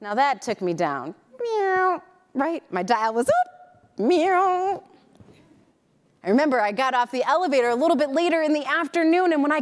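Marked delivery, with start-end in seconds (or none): 1.4-2.0 higher, mock catty meow; playful cat meow. Catty jab at the news. 4.0-4.8 higher, mock catty meow; playful cat meow. Catty jab at the news.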